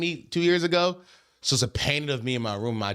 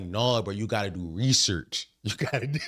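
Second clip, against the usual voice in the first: lightly voiced dad, lower pitch; 6 semitones lower than usual. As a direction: imitate a lecturing dad a little lower. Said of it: "I voice sound when I do it".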